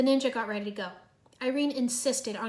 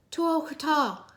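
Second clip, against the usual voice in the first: higher, louder, slow backwards words; shouted backwards talk; 5 semitones higher, 6 dB louder, slower than usual. A narrator's reading. Zane shouts a garbled warning to Cole, loud. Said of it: "he shouted".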